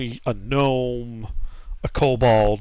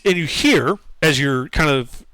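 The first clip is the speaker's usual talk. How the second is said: usual speaking voice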